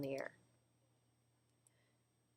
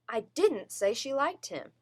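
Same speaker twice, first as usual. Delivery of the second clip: DIDN'T stressed hard, slow insistent denial; firm, slowed denial with stress on DIDN'T; insisting; 3 semitones higher, 4 dB louder than usual. Shown as a demonstration. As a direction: insist slowly, punching DIDN'T. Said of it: "They're insisting that it wasn't them".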